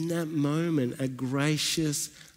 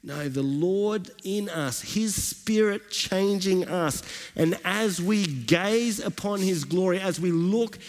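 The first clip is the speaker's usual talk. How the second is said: rising, fervent sermon delivery; preaching; 5 semitones higher than usual. Acted. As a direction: build the praise like a pastor lifting the room. Preaches the passage.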